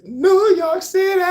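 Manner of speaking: high, drawn-out hype call. Acted. Hyping New York to the listeners, high stretched call. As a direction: belt the city name like an announcer. Calling out the city.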